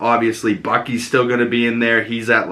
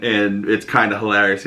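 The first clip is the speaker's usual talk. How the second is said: ordinary talk, nothing marked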